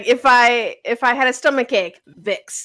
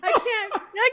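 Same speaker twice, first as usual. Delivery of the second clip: giggling; 8 semitones higher than usual. Real, not acted. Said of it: "I can't even hold it".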